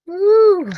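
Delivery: high, loud "woo"; sudden piercing cry of pleasure; delighted whoop. Real, not acted. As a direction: whoop at a gorgeous scent. Reacting to the strong scent.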